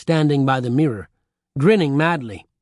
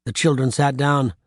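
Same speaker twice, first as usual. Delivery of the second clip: usual speaking voice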